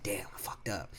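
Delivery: hushed, half-whispered admission; rueful undertone. Retold or imitated. Worker realizes his own mistake, hushed rueful aside. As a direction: drop right down as if to yourself.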